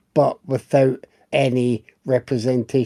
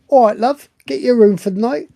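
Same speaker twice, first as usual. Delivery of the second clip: put-on loud, high British accent; mock British barman; 7 semitones higher, 6 dB louder than usual. Barman greeting a guest in a mock British accent. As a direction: play the chirpy British barman. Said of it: "pretending to do some accent".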